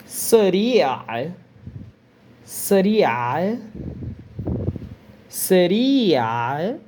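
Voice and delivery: Sari'a with a short first vowel and a long final i, rising end; Arabic word Sari'a. Shown as a demonstration. Teacher drilling Sari'a for students, long final vowel. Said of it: "Eu pronunciei essa parte bem rápida no começo".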